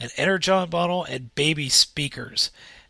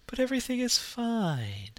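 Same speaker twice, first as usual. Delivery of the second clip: creepy calm; 2 semitones higher, 6 dB quieter than usual. Acted. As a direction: sound unsettlingly serene. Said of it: "Okay, that's just creepy".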